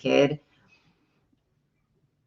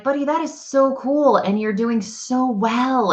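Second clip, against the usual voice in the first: put-on high-pitched, animated kid-talk; gushing praise; 8 semitones higher than usual. Mother praising her little boy, gushing praise.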